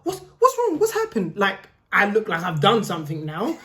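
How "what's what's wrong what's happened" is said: high-pitched mock panic; squeaky, urgent imitation of alarm; imitated alarmed mum. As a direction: fret like a worried mum rushing over. Mum alarmed at daughter crying.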